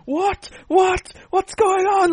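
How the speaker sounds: a hammed-up high squeal of disbelief; shocked disbelief. Jon Stewart hears the Trump news, squealing high in shock.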